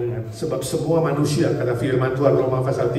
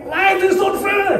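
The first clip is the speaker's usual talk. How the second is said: high mock child's yell; child's shout; 4 dB louder, slower than usual. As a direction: squeal it like a frustrated toddler. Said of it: "dia teriak". (teriakan anak kecil yang pura-pura marah)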